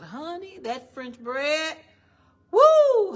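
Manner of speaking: slow and loud, then a high loud whoop; excited craving. Real, not acted. Preacher gushes to the congregation about French bread, slow then whooping. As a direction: savour it, then burst out.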